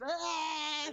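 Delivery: high-pitched mocking yelp; high mock cry. Mocking the president's antics, a high-pitched yelp. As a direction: squeal it like a taunt.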